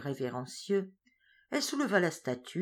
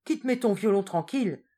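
brisk order; 5 semitones higher, 6 dB louder than usual. Acted. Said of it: "fit-elle ... vivement".

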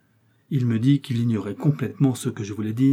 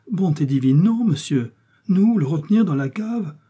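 high, louder, aghast protest; shocked denial; 6 semitones higher, 4 dB louder than usual. Acted. Innkeeper denying the charge to D'Artagnan, high and aghast. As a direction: recoil at the very idea.

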